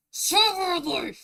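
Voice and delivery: high, loud, drawn-out "severely"; excited. Real, not acted. Streamer gushing about wanting to watch a video. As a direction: gush with urgent want.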